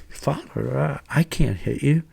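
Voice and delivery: softly voiced reluctant pupil. Young man refusing to hit the priest, imitated soft reluctance.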